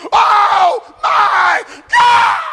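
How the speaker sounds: high, loud, drawn-out cry; preacher's wail. Acted. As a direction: stretch the cry of anguish. Preaching climax.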